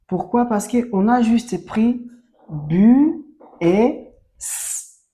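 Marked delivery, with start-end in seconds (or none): 2.5-5.1 slow sounding out. Shown as a demonstration. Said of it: "teacher joins syllable and letter".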